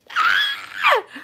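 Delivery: very high squeal of laughter; real shrieking laugh. Real, not acted. Reader agreeing she was a handful, shrieking laugh. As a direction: burst into a high, helpless cackle.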